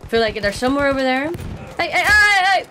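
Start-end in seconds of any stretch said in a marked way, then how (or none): 1.8-2.6 startled yelling, high and loud; sudden scream. Real, not acted. Gamer startled mid-match, screaming hey loudly. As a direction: shout hey in alarm at an enemy. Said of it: "I don't know why I screamed".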